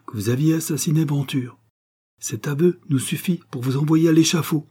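high, stern accusation. A narrator's reading. Doctor threatening the valet with the scaffold, stern. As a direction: press the charge home as a threat. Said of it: "dit sévèrement le docteur".

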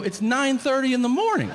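outraged at the early hour; indignant protest. Retold or imitated. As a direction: fire back in disbelief, loud and high. Indignant reply to insult.

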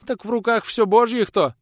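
high, loud lament; wailing women. A narrator's reading. Women lament before the visitor, high wailing. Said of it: "запричитали женщины".

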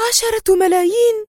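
very high, amazed question; high-voiced astonishment; astonished. A narrator's reading. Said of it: "هتفت راشيل في دهشة".

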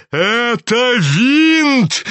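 high, very slow indignant exclamation; drawn-out cry. A narrator's reading. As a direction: cry it out, stretching each word. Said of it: "кричал генерал".

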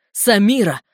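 loud, urgent call of a name; yelling himself hoarse. A narrator's reading. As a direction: shout down the phone. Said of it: "надрывался в трубке брат". (надрываясь, громко в трубку)

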